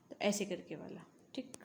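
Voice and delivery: whispered aside; whispering to herself while writing. Real, not acted. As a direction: drop to a whisper mid-explanation.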